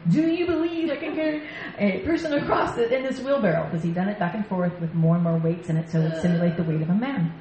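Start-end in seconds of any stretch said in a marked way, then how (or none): 0.1-3.7 high, hammed-up French accent. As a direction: address the crowd as a would-be Frenchman. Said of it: "in a really good French accent".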